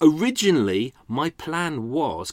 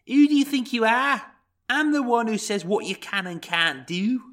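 Scouse scream; 11 semitones higher than usual. Acted. John Sweeney shouting down a victim, Liverpool accent yell. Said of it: "this is my Liverpudlian impression of John Sweeney".

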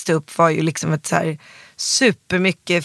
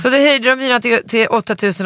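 higher and louder, still worked up; heated re-telling; indignant retelling; 6 semitones higher, 4 dB louder than usual. Real, not acted. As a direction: repeat it still outraged. Repeating the rent hike, indignant.

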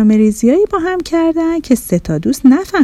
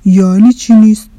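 loud, mildly alarmed question; startled worry; 6 dB louder than usual. A narrator's reading. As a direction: jolt awake and demand an answer. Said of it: "با نگرانی از خواب پرید و گفت". (نگران و از خواب پریده)

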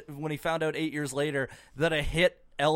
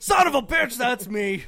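loud, high character shout; 9 semitones higher, 10 dB louder than usual. Retold or imitated. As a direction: yell it in a put-on voice. Frank voiced in joke.

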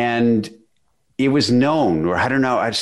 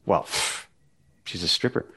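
dismissive mock sneer; 4 semitones lower, 6 dB quieter than usual. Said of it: "Well, that girl".

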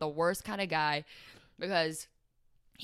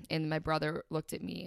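ordinary delivery

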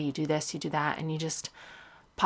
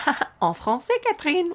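French teacher; 12 semitones higher, 5 dB louder than usual. Retold or imitated. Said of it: "the teacher's like".

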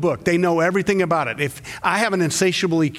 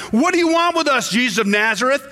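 cried out; 7 semitones higher than usual. A narrator's reading. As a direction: cry out at Jesus in alarm. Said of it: "cried out".

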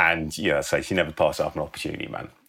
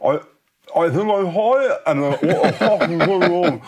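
high, slurred, numb-mouthed voice; numb mouth talk; 12 semitones higher, 6 dB louder than usual. Acted. Friends high on anaesthetic, talking through numb mouths. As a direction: talk as if your mouth is full of bread. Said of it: "talking like they got, like, a mouthful of bread".

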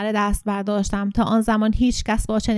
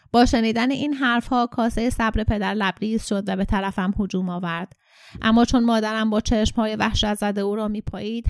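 ordinary delivery

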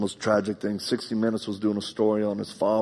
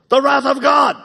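much higher, much louder booming cry; retold yell; 10 dB louder than usual. Dying friend Brent wakes and yells at the pastor, booming shout. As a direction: bellow it like a prophet waking from sleep. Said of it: "And he yells it".

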